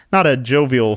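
ordinary delivery, nothing marked

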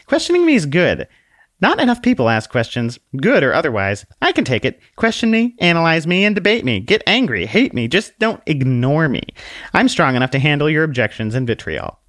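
high, polite little lady; curtsy it out sweetly, voice up high; prim little book voice. Acted. Book introduces herself to the man with a high dainty bow.

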